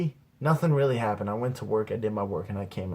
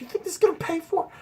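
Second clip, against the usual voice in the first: high, fast grandma imitation; incredulous grandma; faster than usual. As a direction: squeal the question in disbelief. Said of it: "She's like".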